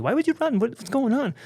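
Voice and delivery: high, cute French-voiced questioning; Annie. French friend to the man who ran, high puzzled French voice. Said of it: "when Annie's telling me".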